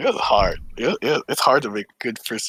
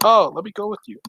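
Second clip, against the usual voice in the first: imitated eager offer; 10 semitones higher than usual. Imitates friend offering to come.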